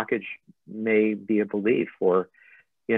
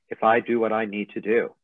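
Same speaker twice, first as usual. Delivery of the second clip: usual speaking voice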